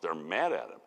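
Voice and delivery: ordinary delivery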